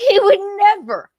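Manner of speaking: high, loud emphatic burst; indignant agreement. Real, not acted. Man seconds co-host's defence against the claim, indignant agreement. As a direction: jump in high and forceful.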